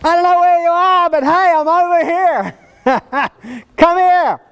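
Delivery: a half-laughing shout to someone far off; crying out. Retold or imitated. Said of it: "is crying out".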